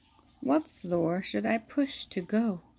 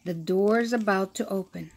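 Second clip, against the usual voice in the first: ordinary delivery, nothing marked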